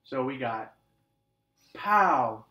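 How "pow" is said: excited. Shown as a demonstration. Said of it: "with an exclamation mark".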